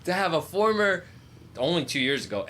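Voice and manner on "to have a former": high excited fan rant. Real, not acted. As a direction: hype it up like a die-hard fan.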